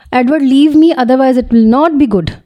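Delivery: high, slow yell of warning; yelled from the throat. A narrator's reading. Girl yelling at Edward to let go, yelling. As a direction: shout him off with full throat. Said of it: "حلق کے بل چلائی تھی".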